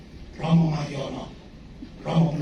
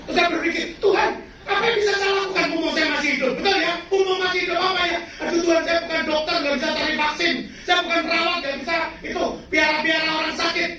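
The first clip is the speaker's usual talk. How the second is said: much higher, louder joyful preaching; fervent preacher testimony; preaching at full force; 12 semitones higher, 6 dB louder than usual. Real, not acted. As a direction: proclaim it with fervour.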